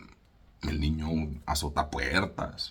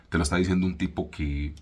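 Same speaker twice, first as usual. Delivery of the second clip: normal talking voice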